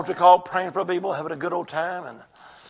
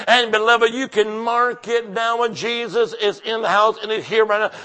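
ordinary talk, nothing marked

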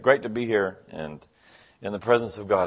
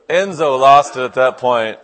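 loud, laughing over the words; can barely get it out for laughing; 5 semitones higher, 12 dB louder than usual. Real, not acted. Preacher laughing at his own punchline, loud.

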